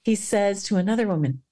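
ordinary delivery

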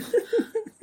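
quick high laugh; high burst of laughter. Real, not acted. Laughing at own line.

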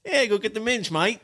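Australian accent. Shown as a demonstration. Showing friends minge in Australian, attempted Australian accent. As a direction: try a mock Australian line. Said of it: "you gotta say minge".

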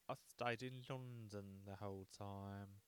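slow, drawn-out fake London accent; badly faked British accent, trailing out; terrible British accent. American defending the trip, terrible British accent. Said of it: "my British accent has always been terrible".

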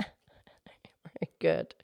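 whisper. Real, not acted. Praising after the twister.

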